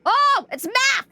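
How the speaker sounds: high, loud, slow exclamation; excited yell. Real, not acted. Streamer solving a puzzle, yelling in excitement. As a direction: shout out the discovery. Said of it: "Sorry, I'm yelling".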